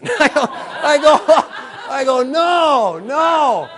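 high, loud, laughing wail of refusal; comic panicked no. Retold or imitated. The driver told to make a U-turn, wailing no in loud laughing panic. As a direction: cry out no in mock despair.

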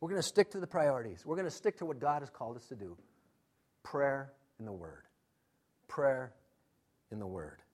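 calmly. Retold or imitated. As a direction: leaders settling a worried crowd, quietly calm. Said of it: "They just calmly say".

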